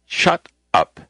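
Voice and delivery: a stern retold order; sharp shut up. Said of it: "I say".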